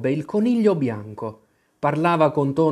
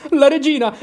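much higher and louder cry of alarm; alarmed exclamation; 11 semitones higher, 8 dB louder than usual. Acted. Cinque spots the queen coming, louder alarmed cry. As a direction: shout the warning. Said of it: "esclamò".

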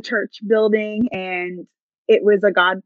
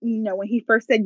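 normal talking voice

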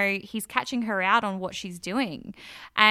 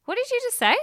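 startled question; 5 dB louder, much faster than usual. Retold or imitated. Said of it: "And then he goes".

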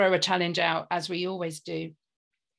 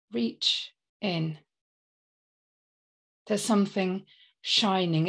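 poem recital. Acted. Improvised heartfelt poem recital.

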